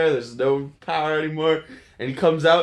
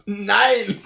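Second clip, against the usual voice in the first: a hammed-up German "Nine" in a higher voice; German accent; 7 semitones higher, 4 dB louder than usual. Friend asks for the German line again, mock German accent. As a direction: say Nine like a comic German scientist. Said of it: "again, more Germanly".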